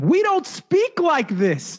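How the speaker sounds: high, drawn-out mock scream; retold screaming. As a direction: cry out in exasperation, stretching the words. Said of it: "just been screaming the whole time".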